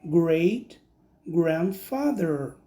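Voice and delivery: slow careful English "great grandfather"; English word. Shown as a demonstration. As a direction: say "great grandfather" slowly, each syllable clear. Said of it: "eu vou dizer". (pronúncia lenta e clara de "great grandfather")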